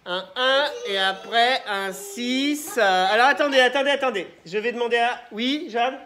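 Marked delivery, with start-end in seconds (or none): 0.0-2.8 un un et après un six said slowly, each word apart; slow repeat. Shown as a demonstration. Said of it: "Je vais vous dire le nombre".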